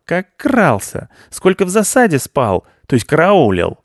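high, loud indignant tirade; outraged rant. A narrator's reading. Ryzhopius rants at the cat who called his mouse filth. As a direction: erupt in wounded outrage. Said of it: "возмутился Рыжопиус".

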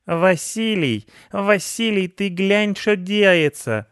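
high, loud, drawn-out lament; wailing old woman. A narrator's reading. Pensioner calling husband to see the cat, wailing. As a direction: wail for your husband in alarm. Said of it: "запричитала она".

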